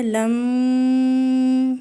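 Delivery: فلما very slow with a long humming nasal م; ghunnah on meem. Shown as a demonstration. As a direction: hold the doubled م of فلما in the nose. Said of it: "میم شد پہ غنہ ہے". (فلما میں میم پر غنہ)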